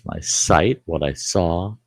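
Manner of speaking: ordinary talk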